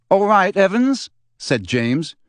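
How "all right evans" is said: pleasant. Acted. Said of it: "tone of his voice was suddenly pleasant, deeper, more mature".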